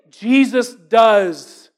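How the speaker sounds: slow emphatic. Real, not acted. Preacher lands the climax.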